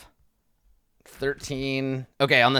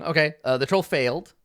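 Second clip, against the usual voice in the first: ordinary delivery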